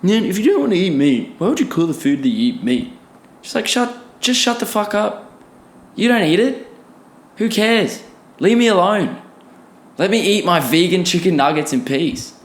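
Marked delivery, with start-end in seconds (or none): 0.0-2.9 hint of a smug podcaster; mocking a podcast bro. Imitating podcasters mocking vegan meat, lightly bro-ish. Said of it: "I hear it on podcasts too". 3.4-11.7 pitched up, fed-up outburst; higher and exasperated, pushing them away; exasperated rant. Real, not acted. Vlogger ranting at critics to leave him alone, pitched up and fed up.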